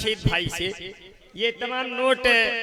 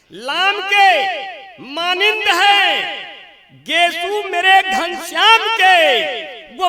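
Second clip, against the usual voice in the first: poetic recital; 6 semitones higher, 7 dB louder, slower than usual. Acted. Reciting the couplet climax.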